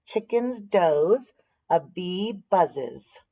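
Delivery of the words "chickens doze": usual speaking voice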